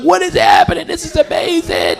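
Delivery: high-pitched mock astonishment; amazed exclamation. Onlooker watching the standing ovation, high-pitched amazement. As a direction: exclaim in high, stunned delight. Acts out his astonished reaction.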